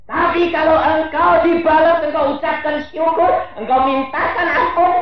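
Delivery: high, loud preaching; preaching at full force. Acted. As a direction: proclaim it to the crowd.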